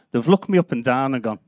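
usual speaking voice

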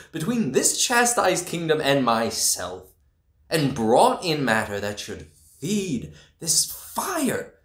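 high, heated declamation; climbing in passion, pitched high; impassioned rise. Acted. Rising defiant tirade.

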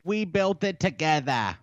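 put-on high-pitched Boston accent, dropped r's; mock Boston accent. Co-host tries out the line, mock Boston accent. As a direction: toss it out in high, broad Bostonian. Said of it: "in a Boston accent".

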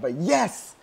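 loud, high burst of celebration; triumphant yes. Real, not acted. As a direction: let a victory cheer burst out. Celebrating despite holding back scream.

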